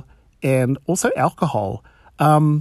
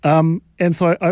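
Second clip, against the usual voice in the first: usual speaking voice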